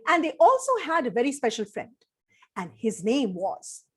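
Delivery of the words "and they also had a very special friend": plain reading voice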